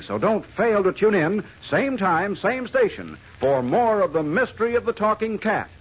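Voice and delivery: loud, high dramatic teaser; old radio announcer. Acted. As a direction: hype the next episode. Closing cliffhanger announcement.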